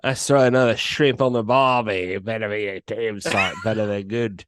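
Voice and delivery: lightly hammed-up Australian accent, half shouting the end. Retelling Murdoch's threat to Cameron, in a mock Australian accent. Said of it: "You gotta say it in the Australian accent".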